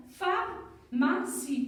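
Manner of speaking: "pharmacie" slow, in three separate beats; syllable by syllable. Shown as a demonstration. Teacher showing three syllables, "pharmacie" slowly split. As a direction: stretch each syllable of "pharmacie". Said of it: "Trois syllabes".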